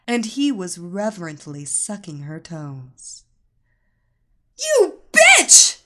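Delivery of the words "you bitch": much higher, much louder scream. Acted. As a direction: scream it at her rival. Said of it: "Cindy screamed".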